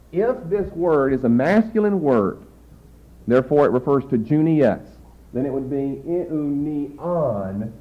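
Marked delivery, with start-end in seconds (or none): 6.0-7.7 eunion drawn out slowly, leaning on the final -on; stress on last syllable. Shown as a demonstration. Teacher showing the masculine word's circumflex, stress on last syllable. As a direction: softly spell out eunion with weight on its last syllable. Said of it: "accent on the last syllable".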